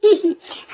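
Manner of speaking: a quick bright burst of laughter; short laugh. Acted. Wife laughing at her husband's jealousy, short laugh. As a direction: laugh it off lightly. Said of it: "तुम हंस रही हो?".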